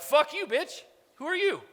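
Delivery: imitating a defensive snap; mock defensive retort. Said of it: "got a little defensive and been like".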